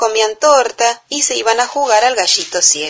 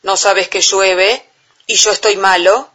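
roaring giant; 4 dB louder than usual. A narrator's reading. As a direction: rumble it like a bad-tempered giant. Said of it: "rugió el gigante".

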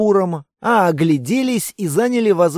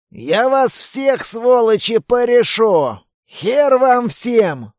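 put-on belligerent drunk; 3 semitones higher, slower than usual. Drunk demon shooting, yelling.

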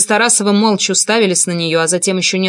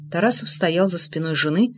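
usual speaking voice

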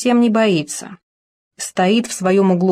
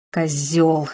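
breathed in helpless fury; 4 semitones lower than usual. A narrator's reading. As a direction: breathe it out in impotent rage. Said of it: "в бессильной ярости выдохнул Макс".

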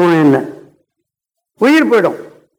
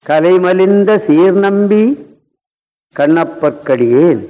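slow verse recitation; 3 semitones lower, much slower than usual. Acted. Recites Tevaram verse line.

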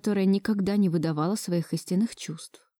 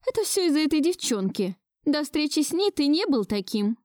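higher-pitched grandmother, complaining; grandmother's familiar grievance; 8 semitones higher than usual. A narrator's reading. Grandmother blaming the new girlfriend, complaining. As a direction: blame the girl in a raised-pitch old woman's voice. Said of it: "Села она на своего любимого конька".

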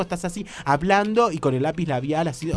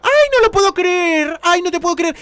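higher, louder, hammed-up gasping delight; hammed-up excitement as a date is shown the rings; mock thrilled; 9 dB louder than usual. Acted date sees engagement rings.

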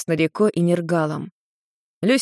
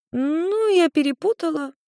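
carefree; 11 semitones higher than usual. A narrator's reading. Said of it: "беспечно ответила блондинка".

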